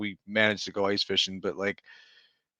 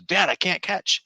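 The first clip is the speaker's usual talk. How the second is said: high, slow imitation of a kid; mimicked child's protest; complaining child; 8 semitones higher than usual. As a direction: whine to dad, pitched up and stretched. Retold child complaining in cold.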